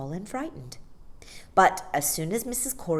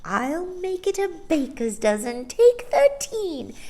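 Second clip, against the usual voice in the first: high, slow, cheerful shrill voice; cheerful old woman; 11 semitones higher, 4 dB louder than usual. A narrator's reading. Old shopkeeper cheerfully offering an extra cake. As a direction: generously round up the order.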